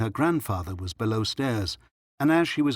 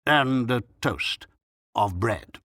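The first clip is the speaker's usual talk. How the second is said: slow, portentous doctor; very dignified tone; slower than usual. A narrator's reading. Doctor confirming the toast, very dignified. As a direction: spell it out with grand gravity. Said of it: "said the doctor in a very dignified tone".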